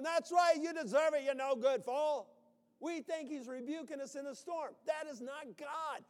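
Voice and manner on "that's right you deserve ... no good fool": loud, much higher gloating rebuke; mock harsh God. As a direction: sneer it down from on high.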